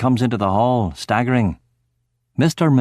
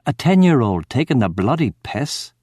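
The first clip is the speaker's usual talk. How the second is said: plain reading voice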